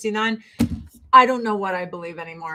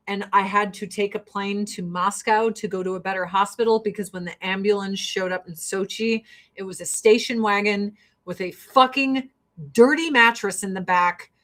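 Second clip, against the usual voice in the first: ordinary talk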